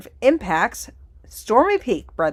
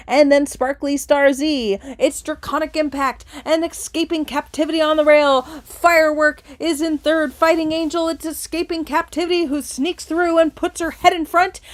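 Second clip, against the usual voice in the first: excited stretch-run call climbing higher and louder; slightly helium-like race call; 6 semitones higher, 5 dB louder than usual. Acted. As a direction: drive to the wire, pitch rising. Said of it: "sound like you've got helium going on slightly".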